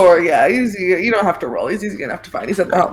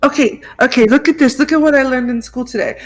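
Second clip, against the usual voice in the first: put-on eager little kid showing off; 2 semitones higher than usual. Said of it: "the little baby voice".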